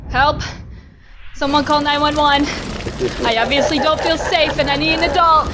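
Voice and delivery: loud panicked cry for help; panicked shouting. Acted. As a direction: yell for help in mock panic.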